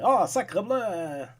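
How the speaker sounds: put-on slow caricature French accent; movie French accent. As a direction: exclaim like a film Frenchman. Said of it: "sound like movie French people".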